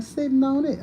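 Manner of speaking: a hint of a sassy grandma; grandma's cheeky answer. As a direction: tease like a sly old grandma. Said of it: "She'd be like".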